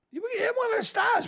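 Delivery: imitating director's refusal. Director turns down the actor's stunt request with a high mock shout. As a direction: bark it out like a brushed-off director.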